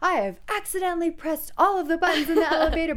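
stern, loud police officer voice; cop voice. Retold or imitated. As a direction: bark orders like a cop. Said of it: "in his cop voice".

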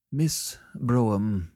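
slow, separated, offended address; spitting out words. A narrator's reading. Sellers answers Annette's insult at last, spitting out the words. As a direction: spit out each word slowly. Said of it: "spitting out the words".

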